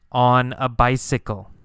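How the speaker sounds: ordinary talk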